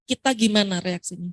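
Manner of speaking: normal talking voice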